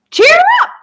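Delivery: very high, loud bird squawk. A narrator's reading. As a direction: squawk it like a parrot. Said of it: "she squawked".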